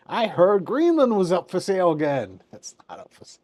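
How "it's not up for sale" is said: breathy whispered retort to himself; a quick unvoiced whisper, under his breath; whispered aside. Real, not acted. Vlogger whispering a correction to viewers, unvoiced.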